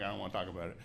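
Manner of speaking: low, soft sulky mumble. Retold or imitated. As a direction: mutter it like a sulking husband. Sulking at wife.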